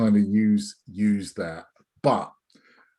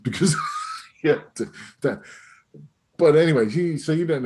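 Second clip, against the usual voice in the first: laughing; 7 semitones higher, 4 dB louder, faster than usual. Real, not acted. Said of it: "I will stand up and demonstrate I'm wearing trousers".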